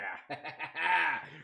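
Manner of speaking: real chuckling laugh. Real, not acted. Streamer amused by his Myrtle coin idea, laughing. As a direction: laugh it out in a run.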